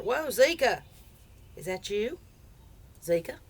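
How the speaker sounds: calling out to the dog, startled; startled shout of a pet's name; surprised call. Real, not acted.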